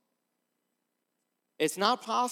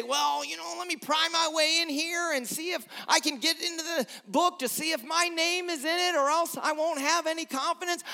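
lightly voiced prying doubter, pitched higher; mock the anxious doubter trying to pry in; a mildly mocking doubter's whine; 9 semitones higher, faster than usual.

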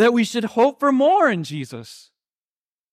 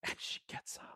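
whisper; 26 dB quieter than usual. Real, not acted. Quiet climax of the miracle.

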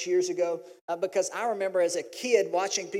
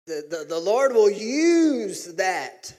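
"use" stretched long and loud; emphatic declaration; 7 dB louder, much slower than usual. Retold or imitated. Father telling wife about strong-willed daughter, "use" drawn out emphatically. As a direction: lean hard and long on "use".

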